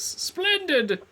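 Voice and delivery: high theatrical exclamation; grand character voice. Acted. Resumes the game figure's voice.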